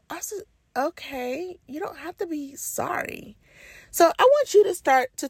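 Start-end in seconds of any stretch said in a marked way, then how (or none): 0.7-3.4 high, soft, puzzled brush-off; dismissive reassurance. Retold or imitated. Reply to apologizing parent, dismissive reassurance. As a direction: let them off softly in a higher pitch.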